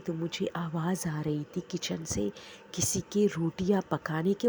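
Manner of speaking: normal talking voice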